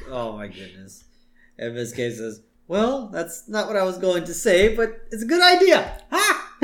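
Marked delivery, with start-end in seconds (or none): 2.7-6.6 high teacher voice climbing higher; hammed-up high glee, ending on "ha"; mock teacher's delight. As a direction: act a teacher seizing an idea. Miss K answering Martin, acted.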